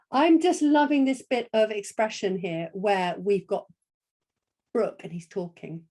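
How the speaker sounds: normal talking voice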